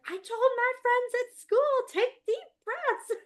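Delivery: put-on very high, loud kid voice, slowing on the last words; little boy sounding out his big advice; proud child. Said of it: "And he's like".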